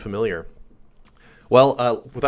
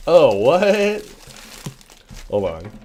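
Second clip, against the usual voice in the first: loud, much higher, startled what; surprised; 6 semitones higher, 9 dB louder, slower than usual. Real, not acted. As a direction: caught off guard by what's in the box. Surprise opening mystery box.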